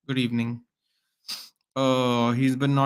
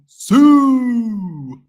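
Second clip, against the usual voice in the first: much higher, louder Siu cry; celebration shout; 9 semitones higher, 10 dB louder than usual. Retold or imitated. Fan belting the Siu goal celebration, loud and high.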